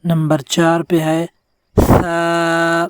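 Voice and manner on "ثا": "ثا" with the tongue tip between the teeth. Shown as a demonstration. Teacher demonstrating to students: "ثا" tongue out. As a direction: push the tongue out a little for "ثا". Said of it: "تھوڑی سی زبان باہر نکالیں".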